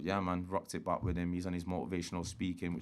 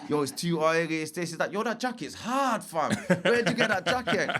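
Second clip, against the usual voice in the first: high, hyped-up imitation of praise; mock admiring, pitched well up; bigging it up; 9 semitones higher, 5 dB louder than usual. Retelling a man admiring his jacket, hyped-up and high. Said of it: "he's bigging up my jacket".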